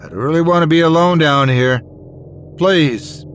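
quick, high, anxious plea; beg, frightened and alone; scared pleading. Acted.

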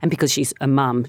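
usual speaking voice

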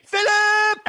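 loud call across the street; yelling a name over four lanes. Retold or imitated. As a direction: shout his name across traffic. Calling out across intersection.

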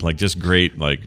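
ordinary talk

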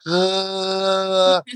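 long, high pretend gargle; drawn-out throaty noise, imitating a toddler; mimicked baby gargle. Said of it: "I gargle, and my baby's like".

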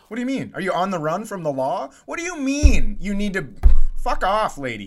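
mad. Pretend. Listener to the absent woman, indignant mock telling-off. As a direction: tell her off, peaking on the curse. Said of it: "I'm still mad".

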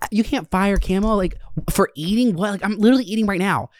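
high, fast, outraged coworker; indignant high voice. Retold or imitated. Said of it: "And she's like".

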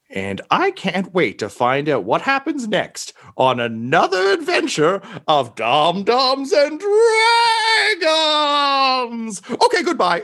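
building high and slow to a loud cry of Dragons; grand send-off. Acted. Robed figure hypes the next adventure, building to a shout. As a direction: crescendo to a triumphant shout. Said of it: "And he just says".

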